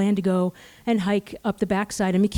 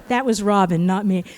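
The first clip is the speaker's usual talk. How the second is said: ordinary delivery, nothing marked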